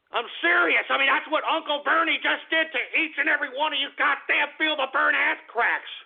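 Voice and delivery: high-pitched, insistent outrage; angry rant. Real, not acted. Host telling supporters they were conned, angry rant.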